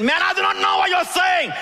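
high, shouted denial; desperate shout. Acted. Peter denying Jesus a third time, shouting high. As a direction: throw the denial out in a panic.

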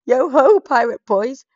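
the chief shouts in alarm to his crew; alarmed pirate chief. Acted. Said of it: "said the chief".

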